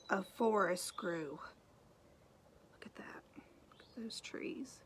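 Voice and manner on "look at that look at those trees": whisper. Real, not acted. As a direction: whisper in wonder at the picture. Pointing out picture to child.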